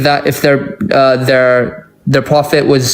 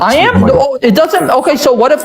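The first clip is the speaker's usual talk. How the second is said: pitched way up, exasperated and quick to object; indignant high protest; 10 semitones higher, faster than usual. Real, not acted. Debater insists to his opponent that he is addressing the point, indignant and high. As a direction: push back hard, voice shooting high. Heated quarrel, accused of not addressing.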